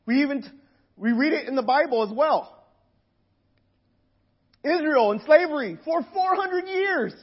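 ordinary talk

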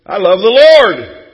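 loud, slowed proclamation; loud churchgoer claim. Acted. Churchgoer boasts faith to Jesus, loud slow declaration. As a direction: proclaim it for all to hear.

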